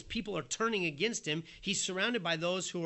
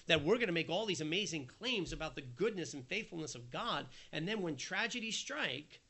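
ordinary talk, nothing marked

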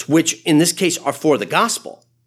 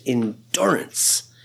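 whispered; 4 semitones lower, 4 dB quieter, much slower than usual. Acted. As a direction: breathe the word endurance, slow and voiceless. Preacher repeats key word.

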